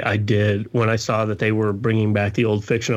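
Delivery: usual speaking voice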